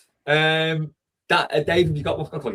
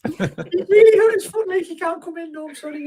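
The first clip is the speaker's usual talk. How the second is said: pretend mum on phone; 6 dB louder than usual. Making excuses as his mum, high falsetto. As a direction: fake a mum in squeaky falsetto. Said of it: "pretending to be your mum".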